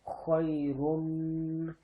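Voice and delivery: خیرن very slow, ending رن sent into the nose; nasal ghunna. Shown as a demonstration. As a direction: draw out خیرن and let the ن hum nasally. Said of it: "ناک میں آواز لے جانے کو کہتے ہیں".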